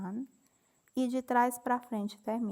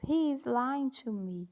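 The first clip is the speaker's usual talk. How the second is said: slow model sentence; 4 semitones higher, slower than usual. Shown as a demonstration. Teacher reading the example sentence slowly to students. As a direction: read "He is lying to me." slowly and clearly, with a raised "He". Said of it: "Aí nós temos".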